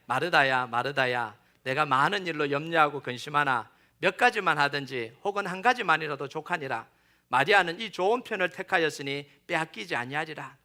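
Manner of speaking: low, measured Bible reading; scripture reading. A narrator's reading. Preacher leading the congregation through the verses, in scripture-reading voice. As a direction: read the verse aloud evenly and low.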